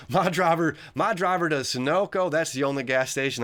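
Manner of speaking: southern accent, hammed up, pitched high and loud. NASCAR fan bragging about his sponsor, in a loud southern accent. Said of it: "I'm gonna southern, little southern accent there".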